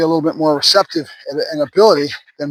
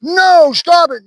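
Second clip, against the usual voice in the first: much higher, louder sudden "No, stop it"; sharp correction; 11 semitones higher, 4 dB louder, much slower than usual. Shown as a demonstration. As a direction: snap at a dog caught misbehaving. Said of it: "When I see the dog doing something wrong".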